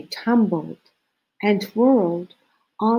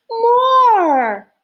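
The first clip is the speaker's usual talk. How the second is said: high, loud shout of "More!"; eager cry; 8 dB louder than usual. A narrator's reading. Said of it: "cried everyone".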